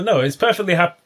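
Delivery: ordinary delivery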